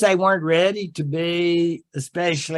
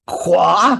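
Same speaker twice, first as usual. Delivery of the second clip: mock French quoi; 7 dB louder than usual. Lecturer mocking the French reaction to the moratorium with a loud quoi. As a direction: throw out an indignant French quoi. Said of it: "And the French are going like".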